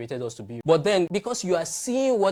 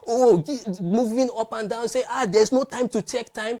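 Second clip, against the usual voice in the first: lightly acted flustered rush, higher pitch; mock frenzy; 8 semitones higher, 5 dB louder than usual. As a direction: imitate someone too harried to stop. Mimics frenzied, hurried people.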